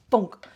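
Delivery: a big, sudden shouted sound effect; loud comic bonk. Retold or imitated. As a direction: hit it like a cartoon impact. Imitating the cat's claw strike.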